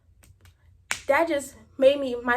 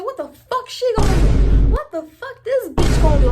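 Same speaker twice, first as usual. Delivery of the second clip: loud, high-pitched indignant outburst; acted-out angry challenge; retold yelling; 6 semitones higher, 11 dB louder than usual. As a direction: shout it in scornful disbelief. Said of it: "I'm yelling at him".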